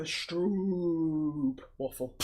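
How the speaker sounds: stroop with a very long held vowel, then waffle. Shown as a demonstration. Co-host teasing about the waffle's name, drawn-out stroop. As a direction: stretch the vowel of stroop right out. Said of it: "it's a long O".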